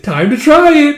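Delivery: high, loud playful cry; gleeful announcement. Acted. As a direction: call it out like a mischievous dare. Playful sudden dare to test hedgehog.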